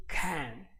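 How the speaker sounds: "can" as a full, isolated "CAN'T"; emphatic negative CAN'T. Shown as a demonstration. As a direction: set the word "can" apart with a full A and a stopped end. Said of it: "all the emphasis will be right here".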